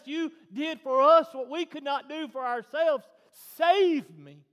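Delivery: building to a high, full-force shout; impassioned preaching. Real, not acted. Preacher on salvation calling to the Father, impassioned shout.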